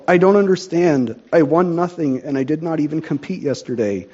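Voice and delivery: ordinary delivery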